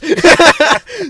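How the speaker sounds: loud, high laugh; real high cackle; burst of laughter. Real, not acted. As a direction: crack up at the punchline. Laughs at friend's deadpan verdict.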